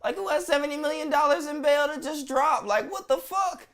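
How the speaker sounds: who has that kind of money, high and stunned; incredulous high outburst. Real, not acted. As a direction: ask it like you can't believe the number. Disbelief at the bail amount.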